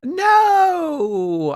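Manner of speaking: high, loud whiny no; high wail no. Real, not acted. Friend protesting there's no intro, whiny.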